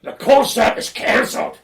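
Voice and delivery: whispered-sounding huffy Scottish announcement, slow; mock sulky Scottish singer calling it off; broad Scottish singer cancelling the gig in a huff; broad Scottish accent. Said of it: "this is a broad Scottish accent".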